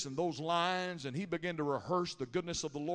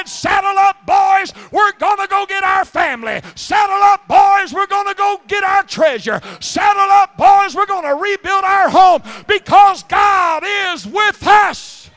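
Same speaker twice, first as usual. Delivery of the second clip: shouted battle cry, much higher and louder; rally the troops at full voice; 14 semitones higher, 18 dB louder than usual. Retold or imitated. David rallying his men to retake their home, shouting. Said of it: "And David said".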